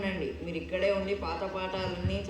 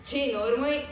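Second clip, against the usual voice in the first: higher-pitched irritated outburst; exasperated scolding; 6 semitones higher, 4 dB louder than usual. Acted. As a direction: shut the pupil up crossly. Guru scolds parroting pupil. (విసుగ్గా గద్దింపు)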